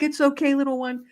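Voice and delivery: high, tender cooing to a little one; soothing baby talk. Retold or imitated. Woman rocking a stunned cardinal, high cooing comfort. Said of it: "holding a baby".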